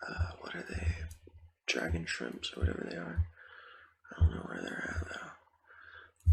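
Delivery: hushed, mostly voiceless game commentary; ASMR whisper. Real, not acted. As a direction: whisper casual gameplay chatter to the listener.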